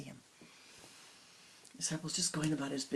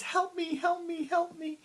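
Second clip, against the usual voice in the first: much higher, louder frightened cry; little girl crying; 14 semitones higher, 5 dB louder than usual. Acted. Girl on the tower cries out to the disciple, frightened. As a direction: wail for help, scared child. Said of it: "as the little girl cries".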